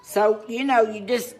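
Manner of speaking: high, loud, pushing through the workout; loud breathless talk. Real, not acted. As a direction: talk loud while pedaling hard. Talking loud over bike exertion.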